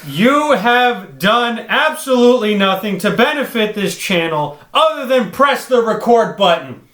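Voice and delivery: loud, slow, emphatic scolding; heated rebuke; indignant rant. Real, not acted. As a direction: lay into him, word by word. Heated argument about channel effort.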